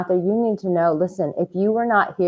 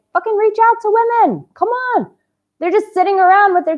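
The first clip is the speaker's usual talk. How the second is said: high, loud urging; rally them to act; fired-up plea to reach out to women; exhorting; 13 semitones higher, 5 dB louder than usual. Real, not acted.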